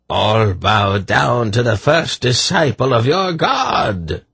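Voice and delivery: high, slow imperious madman; shrill declamation. A narrator's reading. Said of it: "declaimed in his shrill voice".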